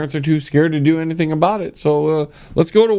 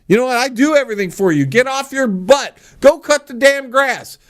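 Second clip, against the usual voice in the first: scolding parent; 10 semitones higher than usual. Retold or imitated. Parent telling a lazy kid to mow the grass, higher and scolding. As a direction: bark orders at the kid with a raised voice. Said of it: "I tell them".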